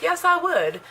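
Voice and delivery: high, eager little yes. Retold or imitated. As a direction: jump at the offer, high and bright. Eager reply to the offer.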